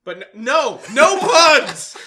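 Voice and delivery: high, loud mock protest; shouting. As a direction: yell it in mock outrage. Mock protest against puns.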